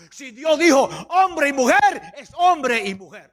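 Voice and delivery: punchy sermon rebuke; emphatic preaching. Real, not acted. Preacher rebukes Congress over God's word, emphatic preaching. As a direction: warn the lawmakers from the pulpit.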